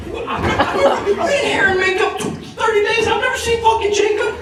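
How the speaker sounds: high-pitched comic indignation; incredulous protest. Retold or imitated. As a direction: protest in hammed-up disbelief. Retells disbelief at unknown Jacob.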